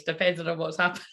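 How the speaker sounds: ordinary talk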